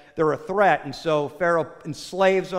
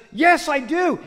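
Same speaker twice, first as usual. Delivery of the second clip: high, louder acted shout back; calling back; 9 semitones higher, 5 dB louder, slower than usual. Stranger answers husband from outside, acted call back. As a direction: answer eagerly from a distance. Unseen drunk man calls back.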